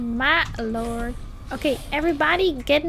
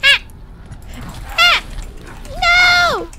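high, loud cry of no; scream; 13 semitones higher, 6 dB louder, slower than usual. Real, not acted. Player grabbed by enemy, real scream.

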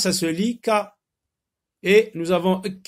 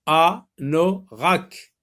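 "Anorak" very slow and louder, split A... no... rak; syllable by syllable; 4 dB louder, much slower than usual. Shown as a demonstration. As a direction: break "Anorak" into three separate syllables with pauses. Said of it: "Dans quelle syllabe entendez-vous".

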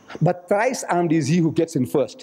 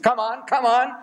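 higher-pitched, insistent retold challenge; impatient badgering; 7 semitones higher than usual. Reverend stamping his feet at the Maulana, impatient badgering. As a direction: press him for an answer.

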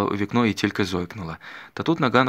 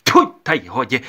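loud, higher scornful spit of a phrase; disgusted spit; 4 semitones higher, 10 dB louder than usual. A narrator's reading. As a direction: spit the words out in contempt. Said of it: "плюнула Докія".

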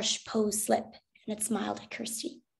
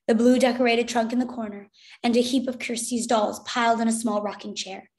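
usual speaking voice

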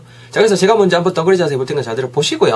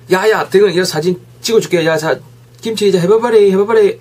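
louder, raised, eager call to a friend; playful photographer calling out; acted friend with camera; 5 semitones higher, 4 dB louder than usual. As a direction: coax a friend to pose, high and loud.

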